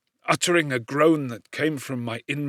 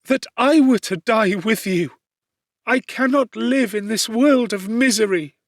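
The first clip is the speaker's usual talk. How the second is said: high, louder despairing plea; anguished cry; 9 semitones higher, 6 dB louder than usual. A narrator's reading. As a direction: wail your wish to die with her. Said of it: "cried she".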